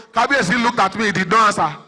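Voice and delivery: ordinary talk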